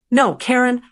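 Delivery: usual speaking voice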